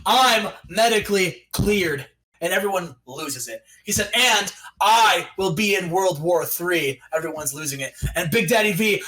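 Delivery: menacing wrestler's challenge; wrestling promo. Acted. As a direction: cut a measured promo, dropping low at the ends.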